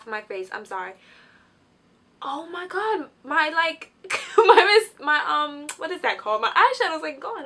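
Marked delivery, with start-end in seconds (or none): 2.3-7.4 high, loud excited babble; real giddy excitement, laughing. Real, not acted. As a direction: tumble over your words with excitement. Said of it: "I'm too excited".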